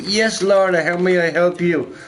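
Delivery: mock-chirpy employee greeting the boss; mock eager employee. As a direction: play the too-helpful worker. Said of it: "she's like".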